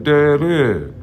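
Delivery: mock a grown son begging his daddy; hammed-up high whining kid; whiny son voice. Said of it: "He like".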